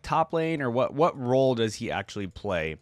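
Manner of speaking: ordinary talk, nothing marked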